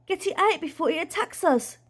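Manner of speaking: high, urgent cry; frightened aunt's high-pitched command; alarmed yell. A narrator's reading. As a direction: order it out in a panic. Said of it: "she yelled".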